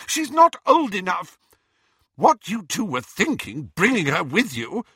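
high, loud scolding. A narrator's reading. Mother scolding her sons, high and loud. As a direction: tell the boys off hard.

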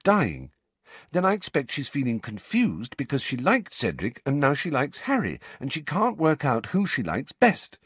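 put-on high-pitched, patient know-it-all girl; Hermione, earnest and knowing, explaining. A narrator's reading. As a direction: spell out the feelings in a high, earnest voice. Voices Hermione explaining Cho's feelings.

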